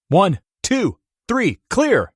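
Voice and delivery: doctor shocking the patient, loud; doctor's count. A narrator's reading. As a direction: count down and call clear. Doctor defibrillating.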